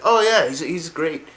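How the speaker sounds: high, loud gushing agreement; put-on eager praise; overly nice. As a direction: gush like someone out to impress. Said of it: "trying to be all nice".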